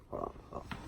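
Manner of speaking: whispered aside; whispering. Pretend. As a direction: whisper it off to the side. Pretend phone call aside.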